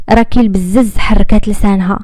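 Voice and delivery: usual speaking voice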